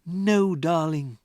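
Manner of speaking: gentle, soothing teacher; calm the child; tender reassurance. Acted. Miss Honey reassuring Matilda, gently. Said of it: "Miss Honey said".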